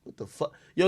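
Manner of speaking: ordinary delivery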